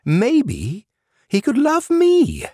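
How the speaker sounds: on the edge of tears; quavering voice. A narrator's reading. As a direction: sleep demon confessing his hope, voice quavering. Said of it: "voice quavering".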